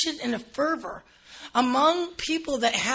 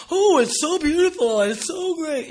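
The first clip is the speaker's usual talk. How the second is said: higher, louder mock gush over treasure; gushing admirers; 5 semitones higher, 6 dB louder than usual. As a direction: gush like pagans admiring chalices.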